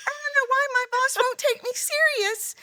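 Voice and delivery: very high, whiny, near-crying complaint; whiny high girl voice. Retold or imitated. Imitating clients whining about being ignored by their boss, very high. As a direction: squeak it out like a timid mouse. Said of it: "they're like".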